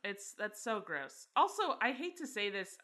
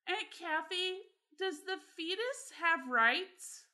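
put-on higher, slow, innocent little-boy question; small boy voice; 9 semitones higher, slower than usual. A little brother asking his aunt in the car, in a high, slow, innocent voice. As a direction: ask it like a curious toddler in the back seat. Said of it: "just goes".

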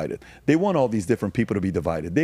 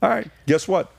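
ordinary talk, nothing marked

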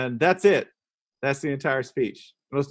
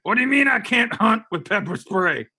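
higher mock-indignant hunter voice; outraged swamp hunter; 6 semitones higher than usual. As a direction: protest like an aggrieved country hunter.

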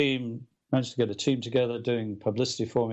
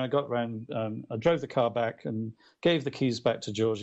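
usual speaking voice

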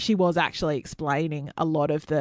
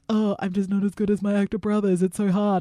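fourth Hemsworth brother; 2 semitones higher than usual. Retold or imitated. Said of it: "they pretended to be, like, the fourth Hemsworth brother".

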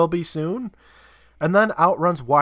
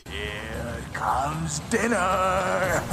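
slow villain taunt; 5 dB quieter, much slower than usual. Retold or imitated. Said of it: "Jake calls out".

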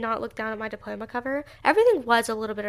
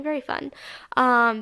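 ordinary delivery

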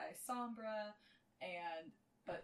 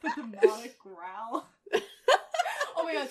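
laughing; 3 semitones higher, 18 dB louder than usual. Real, not acted. Mocking the first voice.

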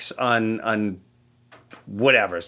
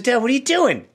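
higher, urgent plea; scared son calling to his dreaming father; alarmed; 11 semitones higher than usual. Retold or imitated.

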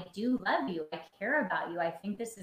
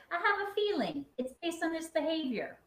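much higher, light modelled request; calm I-statement; 10 semitones higher than usual. Shown as a demonstration. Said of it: "I said".

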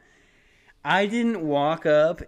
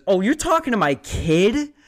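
suspicious mom; 8 semitones higher, 6 dB louder than usual. Retold or imitated. Mom confronting him, suspicious.

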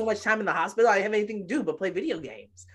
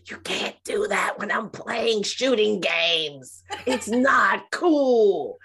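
put-on mortified teen; 4 semitones higher, 5 dB louder, much slower than usual. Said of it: "He's like".

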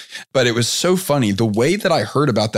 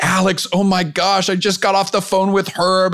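much higher, gushing and amazed; excited member quote; 8 semitones higher than usual. Retold or imitated. Retelling a member's voice memo to the host, high excited gush. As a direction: gush like a thrilled young entrepreneur. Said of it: "I wish I could do his accent, but I can't do his accent".

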